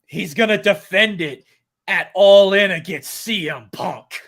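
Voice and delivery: mock tycoon proclamation; Vince McMahon voice. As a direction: announce the main event like the big boss. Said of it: "Why did I do that in a Vince McMahon voice?".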